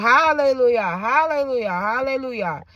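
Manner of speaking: high, loud hallelujahs fading off; grateful mother praising God in the ICU; joyful praise. Retold or imitated. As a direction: praise joyfully, then let it fade. Praising as patients go home.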